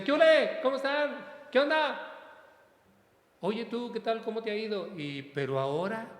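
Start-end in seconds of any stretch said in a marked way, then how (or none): 0.0-2.1 high, loud hail in passing; calling out greetings. Retold or imitated. Priest hails parishioners in passing, high loud calls. 3.4-4.9 high-pitched acted small talk; chatty greeting. Priest asks a parishioner how they've been, acted high chatty greeting.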